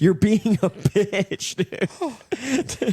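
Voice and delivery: high, slow, laughing mock-hurt voice; mock wounded caller. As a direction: squeak it out like a hurt techie. Mock upset engineer on call.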